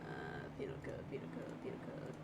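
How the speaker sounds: whispered to himself; whispering. Real, not acted. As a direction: mutter it under your breath. Mutters while searching penal code.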